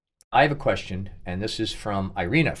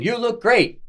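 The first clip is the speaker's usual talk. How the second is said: high-pitched mock compliment; imagined commenter raving; gushing praise; 12 semitones higher, much slower than usual. Voicing imagined gushing viewer comments.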